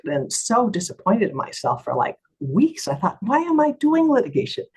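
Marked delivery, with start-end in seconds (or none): 3.2-4.7 very high-pitched retold lament; exasperated self-doubt. Lawyer doubting her career after confrontation, retold exasperation. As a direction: question yourself in a high, exasperated voice. Berating herself after the deposition.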